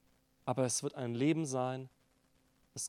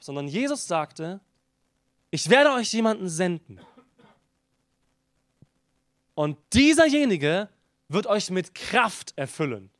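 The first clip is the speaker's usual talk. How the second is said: rising, louder preacher's call, slowing on the key words; preaching at full force; 5 semitones higher, 8 dB louder than usual. Acted. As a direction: preach it out to the whole congregation. Preaching crescendo on the Spirit.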